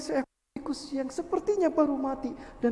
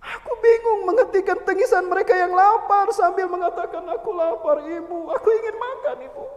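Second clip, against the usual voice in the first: higher anguished voice; lamenting widow; 8 semitones higher, 6 dB louder than usual. Acted. Widow recalls her children, higher anguished lament. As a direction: ache through the children's cry.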